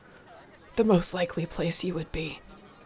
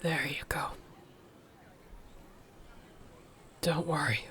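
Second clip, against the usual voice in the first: soft soothing whisper; 10 dB quieter than usual. Acted. Soothing injured partner after waking.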